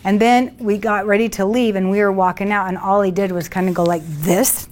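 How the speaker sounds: ordinary delivery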